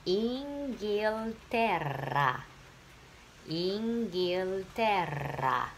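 Inghilterra drawn out very slowly; very slow model reading. Shown as a demonstration. Teacher models Inghilterra for the class, very slow. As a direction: read Inghilterra slowly for students to repeat. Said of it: "Va bene, insieme".

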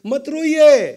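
urgent plea. Acted. Son consoles weeping father.

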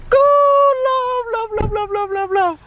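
squeaky high coo to a pet. Real, not acted. Cooing love at dog.

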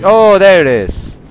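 loud, high, drawn-out alarm; startled cry. Real, not acted. Gamer spotting the flying demon, startled cry. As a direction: cry out as the enemy suddenly appears.

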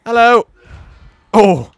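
startled shout. Real, not acted. Player suddenly meets enemy, loud startled shout. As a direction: yelp it out as if caught off guard.

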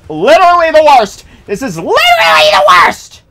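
high shouted complaint; raging gamer. Real, not acted. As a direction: yell it in total exasperation.

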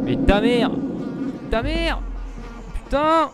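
high startled swearing; scared yell. Real, not acted. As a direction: yelp at the jump scare. Jump scare.

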